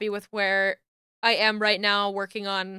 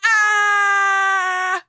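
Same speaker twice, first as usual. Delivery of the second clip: long, high, loud wailing scream; hammed-up cry of pain; mock scream; 9 semitones higher, 8 dB louder than usual. Mock scream about being sunburned.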